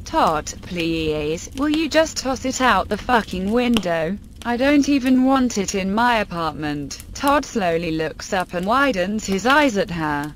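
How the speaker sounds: plain reading voice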